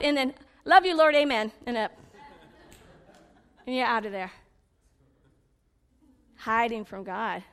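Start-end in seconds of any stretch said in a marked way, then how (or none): 0.6-1.5 loud, quick amen; hammed-up wrap-up of a prayer; mock prayer sign-off. Ending a prayer before God talks, mock sign-off. Mimics hurried end of prayer.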